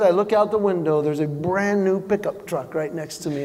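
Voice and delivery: ordinary talk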